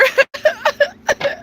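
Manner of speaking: high-pitched burst of laughter. Real, not acted. Laughing at her own fake complaint to viewers. Joking she is not needed.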